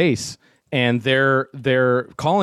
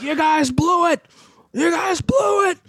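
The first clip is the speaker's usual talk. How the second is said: high, shouted mimic of an angry host; angry yell; slower than usual. Host yelling at crew who showed up late, furious high shout. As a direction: yell it in his face like he's been waiting all day.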